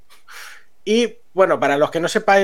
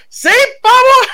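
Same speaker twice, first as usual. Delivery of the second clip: much higher, louder, slow cry of disbelief; astonished shout; 9 dB louder, slower than usual. Real, not acted. Telling friends the pills cost nothing, astonished shout. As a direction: blurt it out, stunned at the price.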